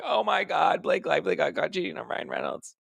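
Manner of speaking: high, squealed mock shock; high put-on voice, softening as it goes; excited gossip. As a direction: pretend to be a scandalized onlooker. Imagines herself as gossiping onlooker.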